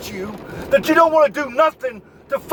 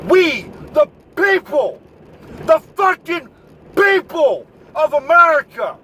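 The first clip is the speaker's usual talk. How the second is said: heated rant; 7 semitones higher, 4 dB louder than usual. Real, not acted. Angry rant quoting the Constitution.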